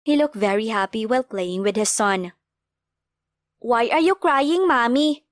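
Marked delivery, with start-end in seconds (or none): 3.6-5.2 put-on higher, slow, gentle little kid voice; child asking. A narrator's reading. Child asking crying mother why she cries. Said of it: "Tanong ni Mati".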